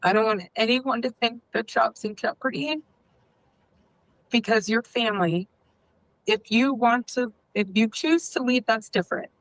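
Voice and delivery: choked up. Real, not acted. Boss reassuring staff about their jobs, choked up. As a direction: near tears, voice lifting as it catches. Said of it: "getting choked up, literally, genuinely choked up".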